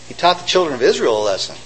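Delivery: ordinary talk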